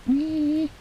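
high gleeful whee; squeal of delight. Real, not acted. Finds the stat sheet.

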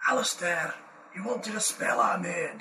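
light Scottish dwarf voice, pleading; Scottish accent. Acted. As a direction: play the dwarf begging to be freed. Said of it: "your fake Scottish accent".

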